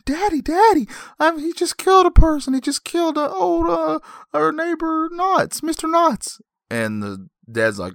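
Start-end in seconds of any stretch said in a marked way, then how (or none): 0.0-6.4 very high mock child voice; small boy. Boy tells dad of a killing, high frantic child voice. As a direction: squeal it like a panicked little kid. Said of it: "he's going to go tell his dad".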